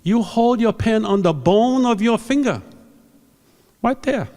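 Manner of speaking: high, loud imitated foreign accent; Fred Eng's accent. Teacher showing students their faulty grip, loud imitated accent. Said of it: "do the accent".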